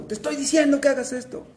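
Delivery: imitated parent yelling. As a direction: play a parent barking an order. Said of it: "que le gritan a sus hijos". (padre gritando, imitado)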